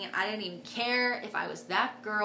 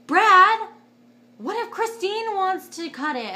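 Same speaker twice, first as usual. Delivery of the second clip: much higher, louder, different-voiced nurse calling out; voicing a nurse; 11 semitones higher, 11 dB louder than usual. A nurse calling to the doctor about cutting the cord, high and loud. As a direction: call across the room to the doctor.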